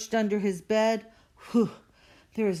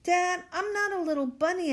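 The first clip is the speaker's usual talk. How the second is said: Jasper protesting; 11 semitones higher, faster than usual. A narrator's reading. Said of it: "said Jasper".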